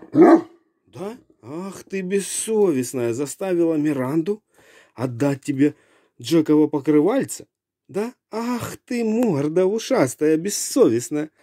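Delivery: put-on high-pitched nagging old-woman scolding; fussy grandma telling off the dog; shrill nagging grandma.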